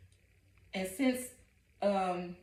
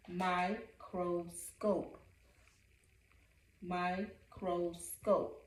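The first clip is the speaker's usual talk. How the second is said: slow syllable-timed; 5 dB quieter, much slower than usual. Shown as a demonstration.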